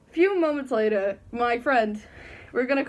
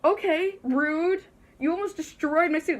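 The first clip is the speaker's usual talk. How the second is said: mock offended; 6 semitones higher than usual. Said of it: "I was just like".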